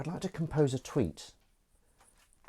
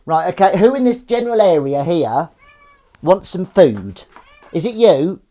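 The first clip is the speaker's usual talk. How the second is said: put-on high, loud baby-talk quiz voice; cat voice; 7 semitones higher, 12 dB louder than usual. Calling his kitten over for tuna in a high, loud cat voice. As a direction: coax the cat with a big high voice. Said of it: "That's the cat voice for you".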